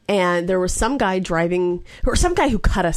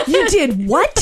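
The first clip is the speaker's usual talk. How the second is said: higher and louder disbelieving what; shocked husband; 9 semitones higher, 5 dB louder, slower than usual. Retold or imitated. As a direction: blurt it out in shock. Corey's shocked reaction.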